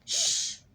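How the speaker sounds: whispered sh; teacher shushing, finger on lips; shushing sound. Shown as a demonstration. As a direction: hush like a finger on your lips. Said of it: "حط ايدك على بقك".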